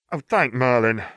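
sharp, rising sibling snap; a jumpy brother snapping at his sister; snapped. Acted. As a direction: snap at her like a protective big brother. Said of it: "Ron snapped".

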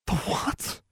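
soft, high, whispery question; faint disbelief. A narrator's reading. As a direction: ask it faintly, as if about to swoon. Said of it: "looking faint".

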